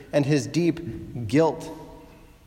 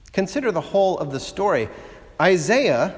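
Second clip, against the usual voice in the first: usual speaking voice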